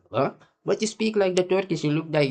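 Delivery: high-pitched mock confusion; retold puzzlement. Speaker challenging his Kurdish acquaintance, retold baffled objection. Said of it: "I'm like".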